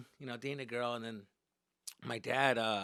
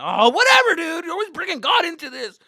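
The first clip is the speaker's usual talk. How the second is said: put-on high, loud teenage outburst; acted exasperated yell; 16 dB louder than usual. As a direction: shout mom down, fed up. Acted teen yelling at mother.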